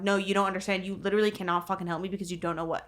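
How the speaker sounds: normal talking voice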